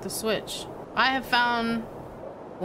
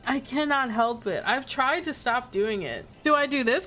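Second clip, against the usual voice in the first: normal talking voice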